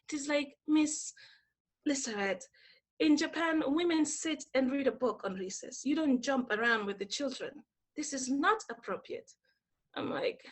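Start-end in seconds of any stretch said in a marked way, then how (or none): 0.7-9.3 softer, prim scolding lecture; a hushed correction of a teacher's behaviour; imitating prim quiet boss. Boss telling her women sit and read, hushed scolding. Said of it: "she said very quietly".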